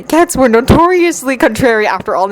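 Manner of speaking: ordinary delivery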